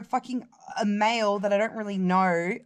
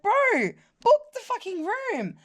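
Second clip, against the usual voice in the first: exasperated demand; 10 semitones higher than usual. Retold or imitated. Exasperated retold demand to him.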